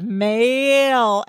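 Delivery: "Mail" drawn out into a curled L; tongue-curled MAIL. Retold or imitated. [name] demonstrating with chopsticks, "Mail" long with curled L. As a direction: lengthen "Mail", curling the tongue at the end. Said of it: "curls his tongue around to get the".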